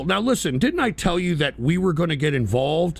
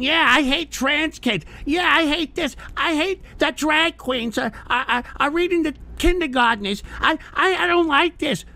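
mocking whiny critic; 8 semitones higher than usual. Streamer mocking his online critics in a whiny voice. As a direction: mimic the complainers with a high whiny sneer. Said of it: "So while you people are out there talking a bunch of shit, I'm talking".

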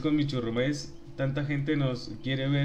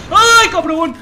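a much higher, much louder shriek of fright; startled yell; 18 dB louder, much slower than usual. Real, not acted. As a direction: yelp in real shock. Sudden startled outburst in game. (grito de susto muy agudo y fuerte)

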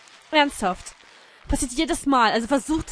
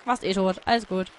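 ordinary delivery

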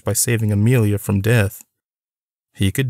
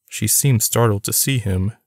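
usual speaking voice

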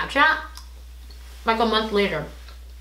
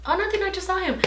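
high, airy voice of a friend brushing it off; lightly mocking innocent tone; mock breezy friend; 10 semitones higher, 4 dB quieter, faster than usual. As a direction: imitate someone who says it's nothing.

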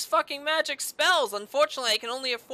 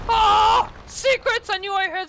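much higher, loud gleeful cry; excited shout; 9 semitones higher, 6 dB louder than usual. Real, not acted. Gamer uncovering a secret room, gleeful shout. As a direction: yell with delight at the discovery. Discovers hidden area.

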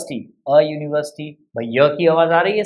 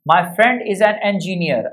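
slow dictation; much slower than usual. Shown as a demonstration. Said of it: "with examples for better understanding".

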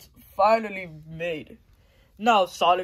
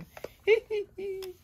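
high, far-off evil cackle; villain cackle; 12 semitones higher, 3 dB quieter than usual. Acted. Defeated Eggman cackling before exploding, high evil laugh.

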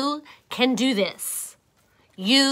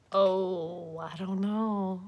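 ordinary delivery, nothing marked

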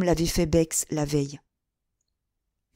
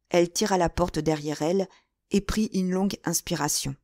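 plain reading voice

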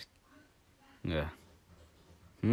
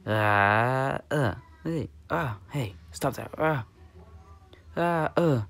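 alarmed cries; 5 semitones higher, slower than usual. Pretend. Character attacked, protests.

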